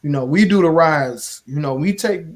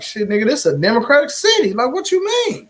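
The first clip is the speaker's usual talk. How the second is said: fast, rising disbelief that jumps far higher at the end; incredulous retort; 8 semitones higher than usual. Retold or imitated. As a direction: fire back like they just said something absurd. Retold argument with organizers.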